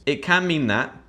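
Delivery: ordinary delivery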